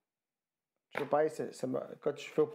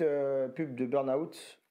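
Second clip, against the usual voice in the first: usual speaking voice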